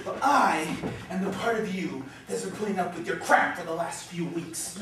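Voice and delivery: low, weary scolding; scornful. Acted. Inner voice fed up with Oscar's crap, scornful.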